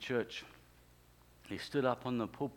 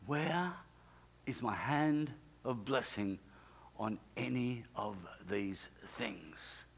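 slow, hushed voice of God; solemn questioning; 3 semitones lower, 6 dB quieter, slower than usual. Retold or imitated. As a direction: ask gravely, word by word, fading almost to a whisper.

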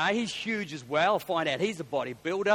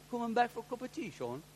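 put-on Pommy accent; 6 semitones higher, 4 dB quieter than usual. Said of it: "little Pommy accent".

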